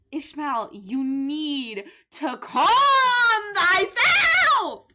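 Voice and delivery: building to a high loud shout on thyself; exasperated shout. Real, not acted. Gamer yelling at her character to calm herself, high and loud. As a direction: yell at the raging character to calm down.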